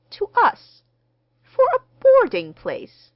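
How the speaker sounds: very high, loud, affronted questions; affronted wife's high-pitched outburst; indignant disbelief. A narrator's reading. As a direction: throw it back sharply, as if insulted. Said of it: "unmistakable frown on Missus James D".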